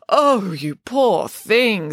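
gushing storybook sympathy; sympathetic. A narrator's reading. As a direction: draw it out with pity. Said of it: "sympathised Nanny Piggins".

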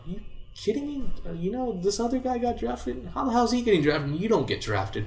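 retold high incredulous rant; outraged disbelief. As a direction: pitch it up in disbelief.

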